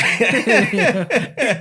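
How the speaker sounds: a high, loud laughing burst; loud burst of laughter. Real, not acted. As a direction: crack up out loud. Laughing at slapped babies joke.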